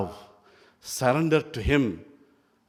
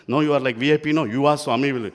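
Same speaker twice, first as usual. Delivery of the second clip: louder, pushy coaxing; urging boys; 2 semitones higher, 7 dB louder, faster than usual. Retold or imitated. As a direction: push your friend to go ask. Boys pushing narrator to ask.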